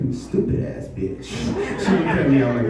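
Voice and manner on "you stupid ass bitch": slow, breathy slip of the tongue; a hint of a muttered slip; mock slip. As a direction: let the insult sneak out. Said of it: "Got a little excited".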